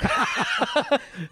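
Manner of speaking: high laugh that breaks out and falls away; burst of laughter. Real, not acted. Co-host cracks up at the joke about seeing the ghoda doctor when sick, high laugh.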